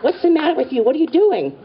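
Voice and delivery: high-pitched indignant husband; retold outraged outburst; exasperated protest. Retold husband protesting being cuffed.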